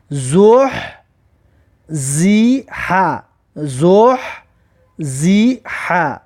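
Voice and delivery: زح زیحه drawn out very slowly; slow recitation. Shown as a demonstration. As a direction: stretch زح زیحه syllable by syllable. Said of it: "حرکت رو ببینید".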